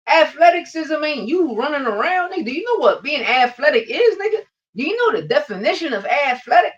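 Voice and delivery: high, loud, exasperated lecturing; heated rant. Real, not acted. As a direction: hammer the point, fed up. Angry rant on athleticism.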